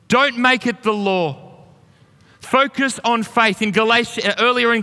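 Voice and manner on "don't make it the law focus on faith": ordinary talk, nothing marked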